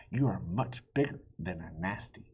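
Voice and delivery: ordinary delivery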